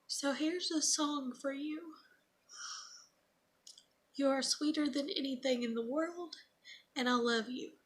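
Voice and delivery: mother reading daughter's birthday note, on the edge of crying; choked up reading. Real, not acted. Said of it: "I'm trying not to cry".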